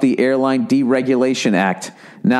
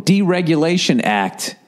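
ordinary talk, nothing marked

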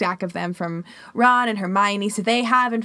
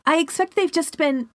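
high squeaky house-elf; a little elf cut off mid-sentence; 8 semitones higher, 4 dB louder than usual. Acted. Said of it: "said Dobby slyly".

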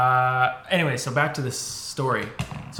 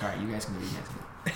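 quiet dismissal; 5 semitones lower, 10 dB quieter, much faster than usual. Retold or imitated. Teacher dismissing the others, retold.

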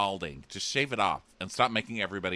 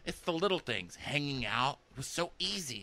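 mock-gushy, raised-pitch quote; lilting, higher mock gush; gushing contestant; 7 semitones higher, 5 dB quieter than usual. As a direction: imitate a gushy reality star, lightly. Recap voicing a gushing contestant.

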